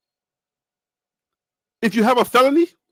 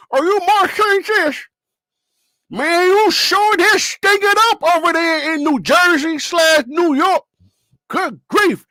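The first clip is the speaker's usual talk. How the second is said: hammed-up Indiana country accent, pitched up high; 6 semitones higher, 3 dB louder than usual. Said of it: "I just wanna get their accent right".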